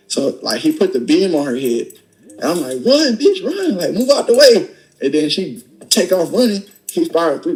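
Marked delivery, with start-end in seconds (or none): 2.9-4.7 very high, desperate yell to run; urgent shout. Retold or imitated. Gun aimed at her head, shouting for his girl to run. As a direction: scream the warning, pitch soaring.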